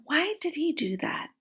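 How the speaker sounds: ordinary delivery, nothing marked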